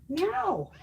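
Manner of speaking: high playful meow at the cat; mock meow. Answering her cat, a high playful meow.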